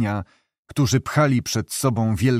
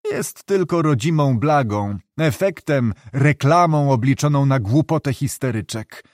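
usual speaking voice

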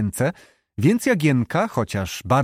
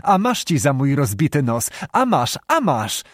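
plain reading voice

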